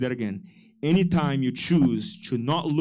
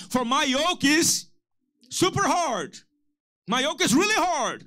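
higher, teasing wrong ending; mock misquote; 9 semitones higher than usual. Shown as a demonstration. Pastor teasing the church with a false verse, mock. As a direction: stretch out the wrong word for a laugh. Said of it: "No, my yoke is easy".

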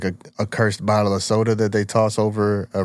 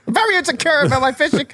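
high mocking whine; mock character voice. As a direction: play the sulky fisher, pitched up. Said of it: "mocking insecure fisher".

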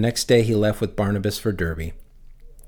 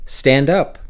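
higher and louder, a commanding call; 5 semitones higher, 6 dB louder than usual. Acted. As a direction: call him to his feet. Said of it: "called to him in a loud voice".

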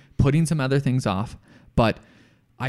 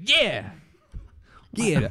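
drawn Southern agreement; Southern contractor; 4 semitones higher than usual. Acted. Host as contractor agreeing, Southern accent. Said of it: "he has a southern accent".

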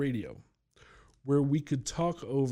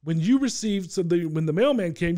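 ordinary talk

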